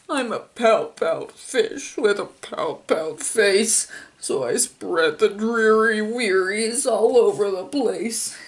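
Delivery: slow, mopey fish voice; glum fish. Acted. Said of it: "Says the fish to his friend".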